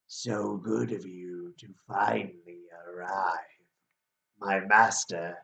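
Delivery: low, hushed chain devil; manly devil voice. Acted. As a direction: greet them as a slow-spoken, deep-voiced devil. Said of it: "You have a very manly voice".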